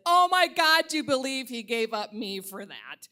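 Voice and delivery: a scandalized cry at the photo; outraged disbelief. Retold or imitated. Outraged message to a friend.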